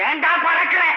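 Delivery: angry rebuke. Acted. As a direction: snap at the hasty son. Father scolds son rushing ahead. (கோபமான கண்டிப்பு)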